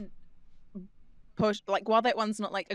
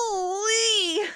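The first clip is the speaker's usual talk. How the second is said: very high, squeezed cartoon catchphrase; high-pitched squeal; 11 semitones higher than usual. Shown as a demonstration. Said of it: "It's quite high pitched as well".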